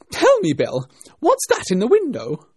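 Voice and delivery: loud, high-pitched demand; angry rabbit. A narrator's reading. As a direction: question the servant sharply at full voice. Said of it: "said the rabbit angrily".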